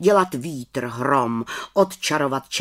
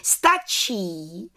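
higher head witch call; 6 semitones higher than usual. A narrator's reading. Head witch stops the test, calling out. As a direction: call a halt, pitched up. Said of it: "zvolala vrchní čarodějnice".

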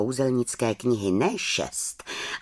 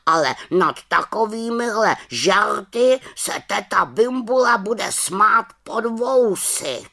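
raven voice; 10 semitones higher, 5 dB louder than usual. Acted.

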